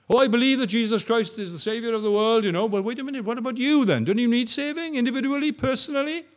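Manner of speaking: high, loud pressing question; challenging. Retold or imitated. Preacher challenging the believer, high and loud. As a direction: press each hearer personally.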